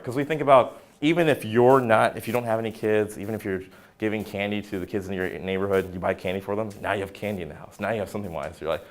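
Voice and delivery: ordinary talk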